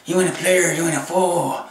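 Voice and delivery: ordinary talk, nothing marked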